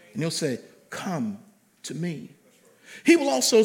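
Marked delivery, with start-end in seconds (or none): 0.9-2.3 low, gentle call; soft divine voice. Acted. Preacher voices God's gentle call. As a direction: speak it softly as God calling.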